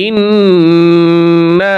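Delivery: ইন্না with the nasal ন্ন held long and slowly; ghunnah demo. Shown as a demonstration. Teacher showing students the ghunnah on ইন্না, slow nasal hold. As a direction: hum the doubled ন্ন of ইন্না through the nose, drawn out. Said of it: "এক আলিফ গুণনা করব".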